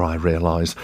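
ordinary delivery

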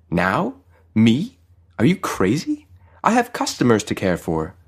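rising, disbelieving questions, then a firm objection; incredulous protest. A narrator's reading. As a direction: balk at an absurd demand. Startled Farid protests angel's announcement.

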